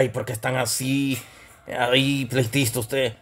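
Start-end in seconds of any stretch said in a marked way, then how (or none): none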